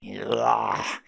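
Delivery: drawn-out throaty groan; exasperated acted groan; disgusted groan. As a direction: groan to cut the talker off. Acted groan at being talked to.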